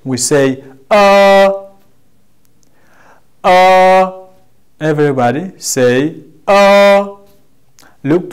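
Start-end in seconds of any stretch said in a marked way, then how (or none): 0.9-4.1 long, high, level "Uh". Shown as a demonstration. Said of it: "guides us to pronounce it in long". 6.5-7.2 long, high, level "Uh". Shown as a demonstration. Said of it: "guides us to pronounce it in long".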